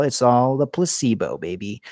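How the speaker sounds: ordinary talk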